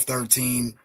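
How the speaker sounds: usual speaking voice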